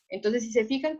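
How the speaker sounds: usual speaking voice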